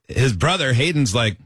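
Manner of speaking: normal talking voice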